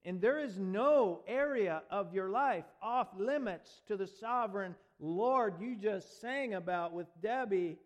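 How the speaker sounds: rising preacher's cadence; fervent sermon delivery; preaching. Acted. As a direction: preach it with building force. Preacher at full force.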